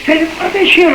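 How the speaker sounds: high, startled cry of a question; startled call to father. Retold or imitated. Son calling to his father on the boat, high startled cry. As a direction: call it out high over the storm, alarmed.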